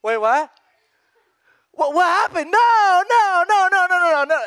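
mock panicked no. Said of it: "and she's like".

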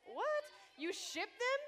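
high, loud shocked "What? What?". Retold or imitated. Youth leader retelling hearing girls say ship, high shocked disbelief. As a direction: act floored by a strange word. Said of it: "And I was like".

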